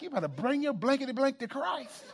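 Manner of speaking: a hint of a hammed-up pulpit threat, laughing; mock cussing preacher. Preacher imagining his boyhood self preaching, mock pulpit threat. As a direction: pretend to preach with the cuss words bleeped out. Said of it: "I'll be like".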